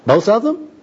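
high, rising incredulous question; baffled disbelief. Acted. Bum questions worker's contradictory advice, baffled. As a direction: shoot the pitch up in bewilderment.

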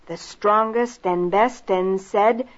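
usual speaking voice

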